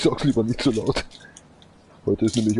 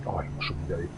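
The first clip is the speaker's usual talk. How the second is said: whispering; 4 semitones lower, 8 dB quieter, faster than usual. Real, not acted. Unvoiced whisper heard.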